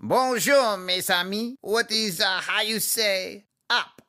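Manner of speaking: exaggerated French accent; french chef. [name] greets in French accent.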